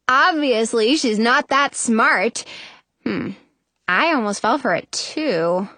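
ordinary delivery

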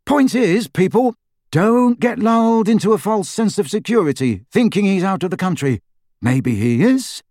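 high, bouncy comic retort; high, animated mock reasoning; lively radio joker. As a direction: play a cheeky radio guest, pitched high.

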